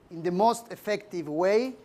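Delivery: usual speaking voice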